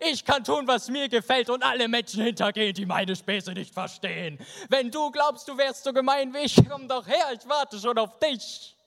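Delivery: high-pitched mocking boast from a treetop; crowing boast. Till crowing from the treetop in a high mock shout. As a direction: crow it out high like a cocky prankster. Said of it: "Und von dort schrie er".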